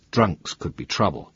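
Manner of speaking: ordinary delivery, nothing marked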